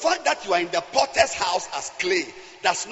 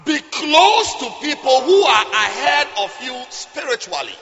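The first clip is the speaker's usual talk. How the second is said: loud fiery sermon; preaching; 3 semitones higher, 7 dB louder than usual. Acted. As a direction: preach it with full force. Preaching at full force.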